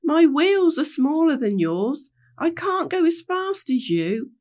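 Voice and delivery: high, plaintive little voice; protesting little character. Acted. Little Wee telling Rainbow they can't keep up, high protest. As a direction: plead from the back of the group. Small character pleading to be waited for.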